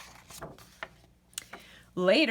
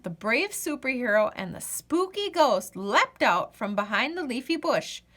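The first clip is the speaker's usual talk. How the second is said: usual speaking voice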